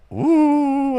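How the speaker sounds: high [name] ooh; a quick high crooned ooh like [name]; imitating [name]. Guest imitating [name]'s ooh in the song, lightly. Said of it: "He's like".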